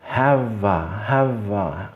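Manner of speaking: "Have a" joined softly as ha-va; palavras ligadas. Shown as a demonstration. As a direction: link "Have" into "a". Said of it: "unindo as palavras". ("Have a" ligado, ha-va)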